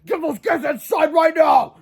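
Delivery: high, loud, slow barked order; furious shout. Acted. Old Crump orders teachers to get kids inside, shouting. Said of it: "he screamed again".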